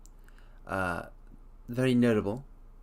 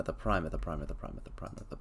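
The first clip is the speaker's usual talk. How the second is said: fading to whisper; 9 semitones lower, 10 dB quieter, much faster than usual. Real, not acted. Repeating phrase, fading out.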